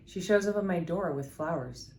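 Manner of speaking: normal talking voice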